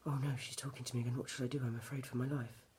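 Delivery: quick, hushed mock panic; soft, hurried mock fear; mocking scared mum. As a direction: imitate a frightened drunk mum on the phone. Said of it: "she's on the phone like this".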